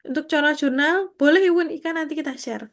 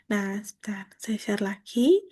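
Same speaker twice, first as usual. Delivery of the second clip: whispered aside; 4 semitones lower, 4 dB quieter than usual. Real, not acted. Aside while sharing screen.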